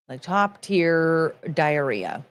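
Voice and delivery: slow, stretched delivery with a rise on top; slow drawn-out. Acted. Streamer drawls a crude joke to chat, slowly. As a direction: drag the words out, lifting on top.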